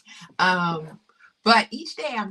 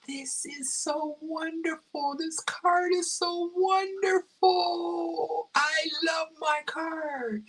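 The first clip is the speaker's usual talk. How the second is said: high, slow, emotional gushing; high wailing joy, imitated; imitating sister's moved delight; 11 semitones higher, slower than usual. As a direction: draw it out like someone overcome by a gift. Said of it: "really got kind of choked up".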